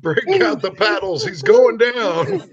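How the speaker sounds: high, urgent mock-medic call; playful panic, pitched high; mock emergency. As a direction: call the alarm, drawing out the last words. Mock medical emergency over Bob.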